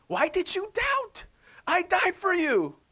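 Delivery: high, slow reproachful voice; mocking guilt-trip Jesus. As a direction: lay on the guilt, hammed up. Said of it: "and then Jesus is saying".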